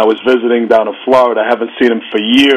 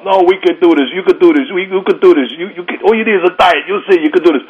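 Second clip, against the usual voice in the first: higher, coaxing wife voice; affectionate nagging; 7 semitones higher than usual. Retold or imitated. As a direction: nag him gently but insistently. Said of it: "she started nagging me about".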